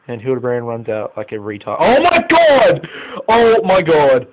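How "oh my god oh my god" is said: high, loud cry of disbelief; real shout of dismay, then easing slightly; shocked outburst. Real, not acted. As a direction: yell it out, long and horrified. Keeper blunder, another goal conceded.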